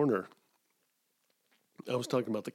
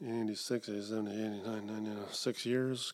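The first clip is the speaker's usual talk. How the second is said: counting under breath; 8 dB quieter than usual. Real, not acted. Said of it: "Counting on my fingers".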